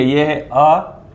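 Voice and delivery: अ slow and short, a light rise; short vowel demo. Shown as a demonstration. Teacher modelling short अ before the long vowel. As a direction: say अ plainly and short. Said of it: "पूरा मुंह खोल के पढ़ेंगे".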